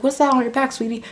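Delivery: very high mock counselor voice; imitated counselor. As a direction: pitch it way up to mimic the counselor.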